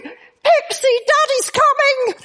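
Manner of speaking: put-on high, excited children's call; kids shouting.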